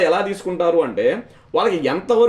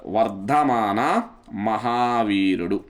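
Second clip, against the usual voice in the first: very slow, lower-pitched, word by word; slow dictation; 7 semitones lower, much slower than usual. Real, not acted. Teacher repeating the next topic's name to students, slow dictation. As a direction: draw each word out for note-takers.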